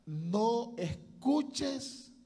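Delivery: "no" drawn out slowly and weighted; stress on no. Shown as a demonstration. Preacher warning his brothers, slow stressed "no". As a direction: lean hard on "no escuches". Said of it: "oiga bien esta palabra".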